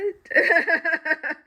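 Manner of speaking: big laugh. Real, not acted. Laughing at own story.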